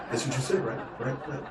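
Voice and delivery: ordinary delivery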